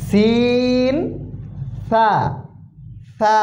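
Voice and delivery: সিন and সা said very thin and light; very thin letter sound. Shown as a demonstration. Teacher shows students the thin letter sound. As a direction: keep সা thin and airy, nothing heavy. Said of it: "পাতলা হবে".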